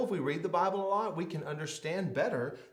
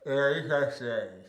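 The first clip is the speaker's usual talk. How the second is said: mouthpiece mumble; slower than usual. Acted. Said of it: "that's pretty close".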